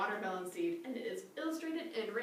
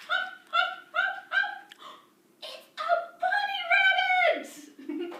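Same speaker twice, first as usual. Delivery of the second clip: much higher, louder, squealing hops; excited bunny game; 8 dB louder, much slower than usual. Pretend. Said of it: "I'll go".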